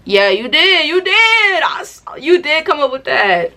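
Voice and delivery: high sarcastic you did; mock praise. Mocking someone who thinks they came up with it, high sarcastic. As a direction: pat them on the head, sarcastically.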